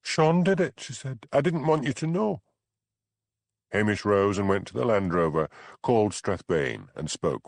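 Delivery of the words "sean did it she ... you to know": high pleading woman. A narrator's reading. Bella blaming her husband to the constable, high desperate. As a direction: rush to blame someone else.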